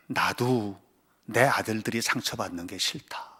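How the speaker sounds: a hint of a low, soft divine voice; lightly voiced God speaking gently; God's voice. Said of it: "하나님이 턱 말씀하시는 거예요". (하나님 음성을 낮고 부드럽게)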